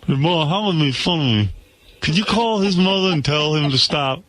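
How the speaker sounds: mock whiny son. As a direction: play a whiny kid begging his mother.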